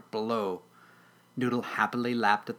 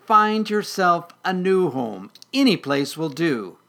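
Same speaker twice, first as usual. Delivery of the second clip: grumbling; 6 semitones higher, 7 dB louder than usual. Acted. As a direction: grumble it loudly, dismissively. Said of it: "the Weedle grumbled".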